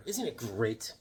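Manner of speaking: normal talking voice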